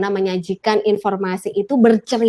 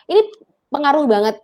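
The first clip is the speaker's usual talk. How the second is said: usual speaking voice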